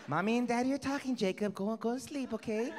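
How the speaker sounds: mock sweet parent. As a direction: coax the kid back to bed. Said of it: "Trying to get the kid out".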